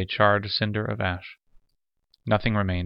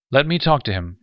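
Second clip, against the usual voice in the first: higher, louder eager cut-in; excited interruption; 5 semitones higher, 6 dB louder than usual. A narrator's reading. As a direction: jump in before anyone else speaks. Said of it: "interrupted excitedly".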